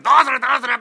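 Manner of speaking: high, loud mocking call; theatre heckle shout. Listeners shout mocking cheers at the master's recital, high loud call.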